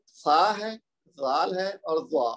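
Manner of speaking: slow, carefully articulated Arabic letters; tajweed letter demonstration; slow letter demo. Shown as a demonstration. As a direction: spell out the sounds slowly for students. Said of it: "وہ کون کون سے ہیں جی".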